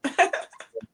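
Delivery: bright burst of laughter; high laugh. Real, not acted. Laughing at the joke.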